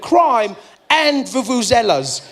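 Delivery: slow, even stress on "Crime" and "vuvuzelas"; deadpan mock Brit. Comic quoting the UK man, slow level "Crime and vuvuzelas". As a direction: say "Crime and vuvuzelas" slowly, both words weighted equally. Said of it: "he went".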